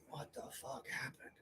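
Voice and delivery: hushed, slow, breathy mumble on waking; a dazed, whispered what-happened; groggy whisper. Retold or imitated. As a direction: whisper it like you just came to hungover. Waking up hungover, confused.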